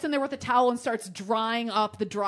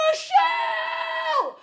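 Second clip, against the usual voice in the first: long high shouted name; calling out; 5 dB louder, much slower than usual. Retold or imitated. Calling Michelle for help, long high shout. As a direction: call her from across the house, stretched out.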